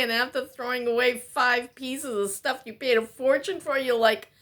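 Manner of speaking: ordinary delivery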